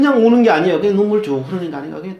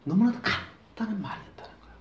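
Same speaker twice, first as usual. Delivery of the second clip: whisper; 6 semitones higher, 6 dB quieter than usual. Real, not acted. Quiet reflection after prayer.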